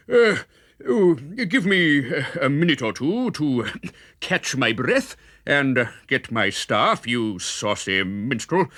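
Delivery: lightly voiced friar catching his breath; breathless friar. A narrator's reading. Dunked friar asking Robin for a minute, lightly winded. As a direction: puff out words between breaths.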